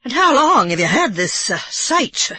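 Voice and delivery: light mock Irish brogue, amused; half-laughing imitation of an Irish brogue. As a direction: tease her with a bit of an Irish brogue. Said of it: "slipping into an imitation of her Irish brogue".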